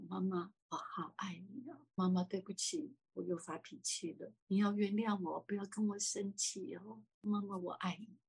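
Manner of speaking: soft, hushed whispered apology; a tearful whisper of love and sorry; whispered in her ear. Retold or imitated. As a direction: murmur it close to her ear, pleading forgiveness. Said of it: "在她的耳边告诉她说".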